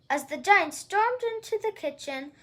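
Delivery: ordinary delivery, nothing marked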